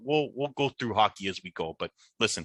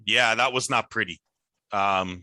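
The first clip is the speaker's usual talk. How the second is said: ordinary talk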